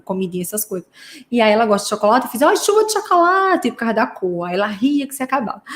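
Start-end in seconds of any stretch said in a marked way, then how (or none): 2.4-3.7 high-pitched playful announcement; brincadeira com criança. Retold or imitated. Recria brincadeira com paciente criança. (anúncio brincalhão em tom agudo)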